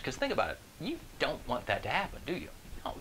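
ordinary talk

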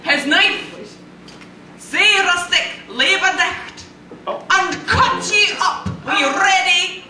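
Burns recited in broad Scots; Scottish accent poem reading, lightly hammed; Scots poem recital. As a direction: declaim the Address to a Haggis in Scots.

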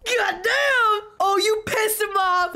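high-pitched excited exclamation; real fan hollering; thrilled outburst. Real, not acted. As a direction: cry out high, amazed by the fight. Thrilled by the angry fighter.

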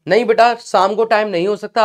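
usual speaking voice

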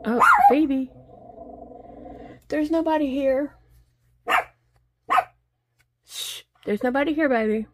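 high, sing-song pet voice; talking to the dog. Real, not acted. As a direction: coax the dog to calm down. Soothing barking dog Phoebe.

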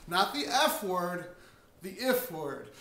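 "if" slow, loud and punched, set against "F"; stress on if. Shown as a demonstration. Teaching real estate callers, stressing the if word slowly and loudly. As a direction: hit "if" hard, drawing each word out. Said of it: "you wanna learn to use the if word".

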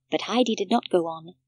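ordinary delivery, nothing marked

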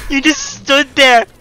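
hyped gamer bro. Real, not acted. Mocking teammate's miss.

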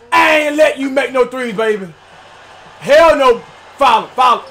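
ordinary talk, nothing marked

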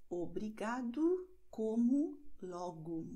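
obrigado as obrigadu, como as comu; obrigado, como, logo ending in u; final o as u. Shown as a demonstration. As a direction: close the last o of obrigado to u. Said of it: "wird als U ausgesprochen".

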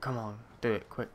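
normal talking voice

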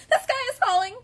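very high, loud frantic squawk; puffing panicked birds. A narrator's reading. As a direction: puff it out in a panic. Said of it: "they puffed".

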